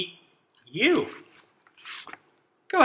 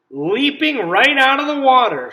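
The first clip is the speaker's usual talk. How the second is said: excited reading; 7 semitones higher, 3 dB louder than usual. Acted. Exciting fact read to kids.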